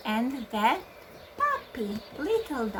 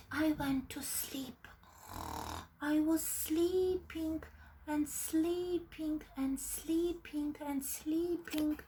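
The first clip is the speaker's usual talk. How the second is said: sleepy whisper; 4 semitones higher, 9 dB quieter than usual. Acted.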